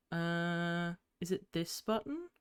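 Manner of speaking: softly unsure, asking herself; quiet unsure question. Real, not acted. Looking for the hotkey, she asks quietly and unsure. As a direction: mumble it to yourself, unsure.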